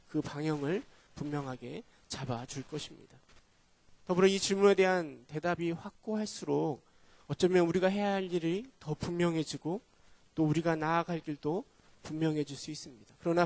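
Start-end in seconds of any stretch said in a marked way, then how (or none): none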